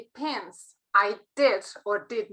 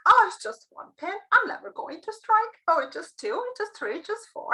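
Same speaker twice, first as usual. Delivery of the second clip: higher-pitched imitation of moaning; mock complaining; 7 semitones higher than usual. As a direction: lightly mimic grumbling bowlers, pitched up. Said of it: "that was all about moaning and complaining".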